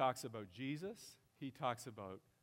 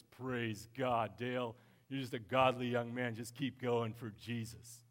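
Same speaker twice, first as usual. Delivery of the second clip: slow, pious imitation of an old pastor; Pastor Jerry's voice; 6 dB louder than usual. Father-in-law praising young Dale, slow pious imitation. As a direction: put on the mentor's blessing voice. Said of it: "with a voice like this".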